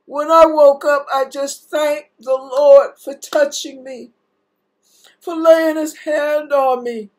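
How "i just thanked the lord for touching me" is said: ordinary talk, nothing marked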